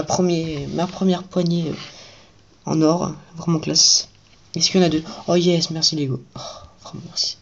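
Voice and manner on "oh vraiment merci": breathy whispered aside; hushed grateful aside; whispered thanks. Real, not acted. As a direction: whisper it to yourself. Thanking Lego for a spare piece.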